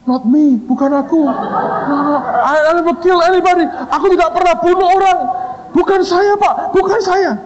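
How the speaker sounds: very high, frantic comic denials; panicked student pleading with the boss; mock terrified. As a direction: squeal your innocence in hammed-up panic.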